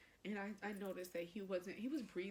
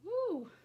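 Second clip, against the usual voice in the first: high, loud "woo" to shake off tears; real overwhelmed exhale, pitched way up; emotional whoop; 14 semitones higher, 10 dB louder than usual. Real, not acted. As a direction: let out a big steadying whoop. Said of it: "I'm gonna get emotional".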